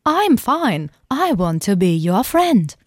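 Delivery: slow, stiff robot voice. Pretend. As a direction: speak like a humanoid robot. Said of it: "ich mach's mal mit dir".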